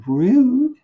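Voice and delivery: high, loud, indignant squeal; mock offended. Juliet finding the poison all drunk, mock offended.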